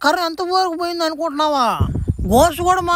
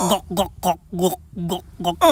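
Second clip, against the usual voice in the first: deep, throaty gulp noises, glug glug; 10 semitones lower, 3 dB quieter than usual. Acted. Alien friend gulping the drink, deep glugging sounds. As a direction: swallow it down in big noisy gulps.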